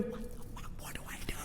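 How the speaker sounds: urgent hushed whisper; mouthed plea for help, barely audible; panicked whisper. Retold or imitated. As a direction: whisper it secretly across the room. Secret panicked question to boyfriend.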